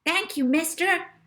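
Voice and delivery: put-on high, loud little boy; young boy thanking the agent loudly; boy's voice. A narrator's reading. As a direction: pipe up like an eager kid. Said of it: "said the boy".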